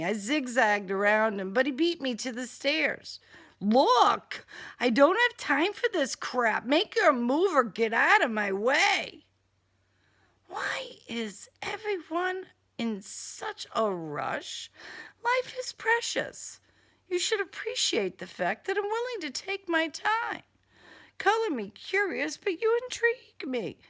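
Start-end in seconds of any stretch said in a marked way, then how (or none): 3.6-9.3 louder confrontation; angry demand. Acted. Heroine confronts killer on stairs. 10.5-14.7 slow, high whispered taunt; menacing whisper. Acted. Killer character voice. 15.2-23.8 higher, silky villain. Acted. Killer character voice.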